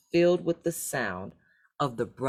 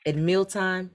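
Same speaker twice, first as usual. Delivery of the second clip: ordinary delivery, nothing marked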